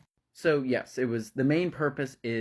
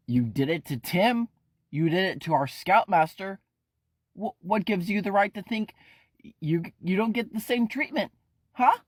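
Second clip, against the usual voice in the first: heated accusation; 8 semitones higher than usual. Acted. Ephraim accusing Kent.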